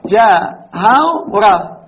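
جهورة stretched out very slowly to show the vowel shift; drawn-out demo. Shown as a demonstration. Teacher to students, showing the shifted vowel, very slow. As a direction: spell out جهورة slowly for the class. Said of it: "نقلناها صارت جهورة".

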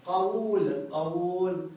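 قول sounded out as qawuul with a long u; original form demonstrated. Shown as a demonstration. Teacher showing students the original form, قول drawn out as qawuul. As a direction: stretch the second syllable of قول, qa-WUUL. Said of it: "نقول أصله هكذا".

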